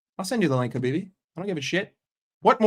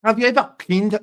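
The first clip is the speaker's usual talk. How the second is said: loud, abandoned stab at Scouse; badly faked Scouse accent; attempted Scouse; 3 semitones higher, 6 dB louder, faster than usual. Shown as a demonstration. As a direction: have a go at Scouse, loudly. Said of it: "Scouse is the one I can't".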